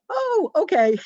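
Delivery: mock-startled high, loud oh okay; flustered clerk. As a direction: play the clerk caught off guard, high and loud. Imitating the flustered man.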